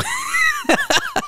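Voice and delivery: sudden high laugh; burst of laughter. Real, not acted. Laughing at the friend's teasing jab.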